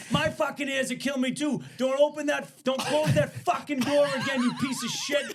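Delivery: loud, high angry yell, breaking into laughs; yelling at the electrician to keep the door open; retold yell. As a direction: shout him down, laughing.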